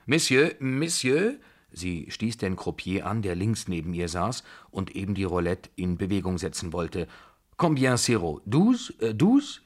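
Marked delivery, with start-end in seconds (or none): 0.1-1.4 high, loud, drawn-out call; rising questioning summons; hailing someone. A narrator's reading. Old lady hailing the croupier. 7.6-9.6 high, loud halting French; sharply rising queries from an agitated old lady; insistent foreign questioning. A narrator's reading.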